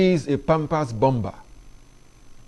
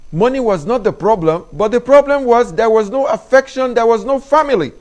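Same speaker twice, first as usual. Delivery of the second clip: rising impassioned appeal; 7 semitones higher, 7 dB louder than usual. Real, not acted. Rising passion about family love.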